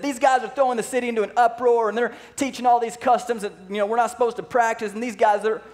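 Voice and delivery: high, loud outraged accusation; angry accusation. Retold or imitated. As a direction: denounce them to the city officials. Masters accusing Paul to authorities.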